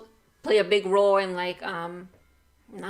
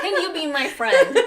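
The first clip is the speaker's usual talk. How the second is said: high, slow question through laughter; hammed-up friend request, laughing loudly; laughing mock plea; 7 semitones higher, 6 dB louder than usual. Mock-interviewing a would-be work friend, laughing.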